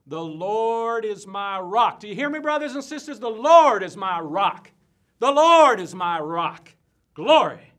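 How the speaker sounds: booming, drawn-out preacher proclamation; loud, stretched-out revival shout; thundering scripture. Acted. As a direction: thunder the verse slowly to the whole tent.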